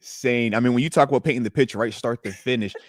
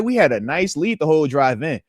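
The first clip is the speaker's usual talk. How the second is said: normal talking voice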